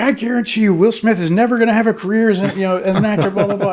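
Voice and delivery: high, loud acted outburst; flustered rant. Flustered record executive trashing his client before the press, acted rant. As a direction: blast the client publicly in a hammed-up rant. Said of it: "he's so flustered".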